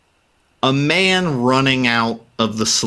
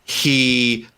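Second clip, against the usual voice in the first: ordinary talk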